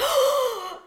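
sharp high gasp of shock. Retold or imitated. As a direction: gasp in mock horror. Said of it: "Blue Steel immediately was like".

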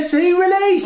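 high loud yell of release; mock fan shout. Fan in the stands yelling at the player, high mock shout. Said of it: "shout talking".

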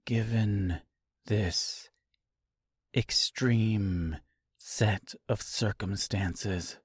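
soft, slow, measured words; hushed, precise restraint; controlled composure. A narrator's reading. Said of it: "drew a deep breath".

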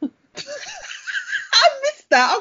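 squealing with laughter, drawn out; laughing. Real, not acted. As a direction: laugh through the words. Laughing hard at the story.

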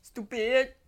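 loud. Real, not acted. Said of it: "Stupid corona".

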